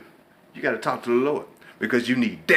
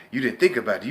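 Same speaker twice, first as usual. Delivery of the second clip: ordinary delivery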